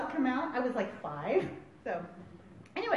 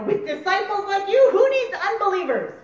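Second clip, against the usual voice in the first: high, loud sarcastic jab; hammed-up scolding, pitched up; mock exasperation; 7 semitones higher, 7 dB louder than usual. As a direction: throw up your hands, loudly. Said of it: "like I would say".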